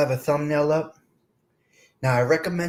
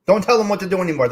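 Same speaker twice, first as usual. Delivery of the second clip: imitating Carmela, louder and fast; a fed-up wife's hammed-up rant; mock exasperated wife; 3 semitones higher, 6 dB louder, faster than usual. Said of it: "she's gonna say".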